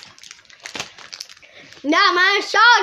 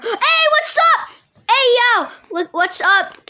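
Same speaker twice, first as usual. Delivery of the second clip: excited greeting; 8 semitones higher, 4 dB louder than usual. Real, not acted. Greets a livestream viewer.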